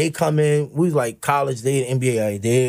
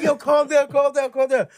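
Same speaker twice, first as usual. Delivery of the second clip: high, laughing calm down; hammed-up soothing teammate; mock calming teammate; 10 semitones higher than usual. Teammate soothing panicked rookie.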